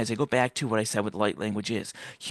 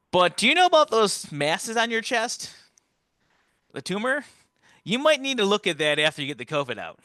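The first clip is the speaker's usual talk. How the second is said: higher, offhand staff voice, only a hint; lightly voiced doctor; 10 semitones higher than usual. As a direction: toss it off like a casual nurse. Hospital staff quoted.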